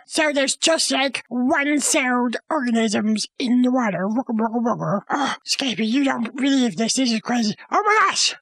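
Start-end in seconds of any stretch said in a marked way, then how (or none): 4.1-5.0 low burbling wugga sounds; silly blob noises. Retold or imitated. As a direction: mimic a single cell wobbling about. Imitating one-celled organisms.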